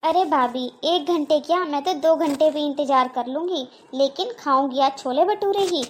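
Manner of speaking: normal talking voice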